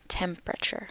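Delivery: "Temperature" softly modelled as TEM-pruh-chur; three-syllable pronunciation. Shown as a demonstration. Teacher repeating "Temperature" for learners, soft three-syllable pronunciation. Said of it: "temperature has three syllables".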